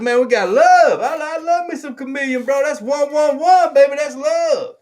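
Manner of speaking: high-pitched, gushing hype; playful hyped affection. Real, not acted. Playful show of love over shirts.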